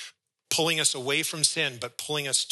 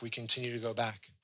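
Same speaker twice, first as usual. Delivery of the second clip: repeated much softer, sinking; soft echo; 3 semitones lower, 9 dB quieter, faster than usual. Real, not acted. Preacher echoes his point to the congregation, much softer. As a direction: let the repeat fall to a hush.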